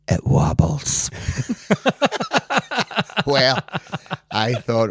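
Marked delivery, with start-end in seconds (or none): 0.1-1.1 conspiratorial whisper. Retold or imitated. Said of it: "she leant into us and she goes".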